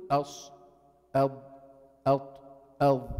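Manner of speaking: أص أض أط أض with the tongue pressed flat, thick and hollow; covered letters. Shown as a demonstration. Teacher demonstrates tongue covering to students, heavy letters. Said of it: "حتلاحظ إنه في".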